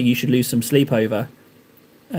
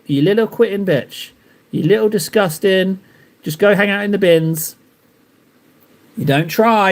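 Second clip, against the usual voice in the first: mock drill-sergeant rant; 3 semitones higher than usual. Mock coach berating quitter self.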